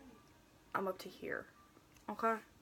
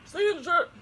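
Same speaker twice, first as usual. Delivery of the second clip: loud; 9 semitones higher, 12 dB louder, faster than usual. Real, not acted. Loud remark to Darren.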